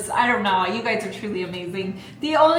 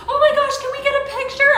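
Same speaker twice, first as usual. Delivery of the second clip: very high, fast gushing fan; imitating a gushing fan, pitched way up; excited fan; 7 dB louder, faster than usual. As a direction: squeal it like a thrilled fan. Fans asking for photo.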